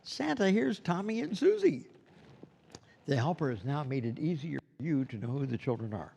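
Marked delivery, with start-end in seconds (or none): 0.0-1.9 put-on bright, much higher voice presenting the kids; helper's introduction. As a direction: announce the children brightly to Santa. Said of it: "the helper comes to you and says".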